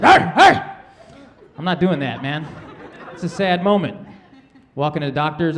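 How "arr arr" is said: high, loud mock dog bark; a comic yapping dog bark; imitating barking dog. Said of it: "dogs are barking at you like".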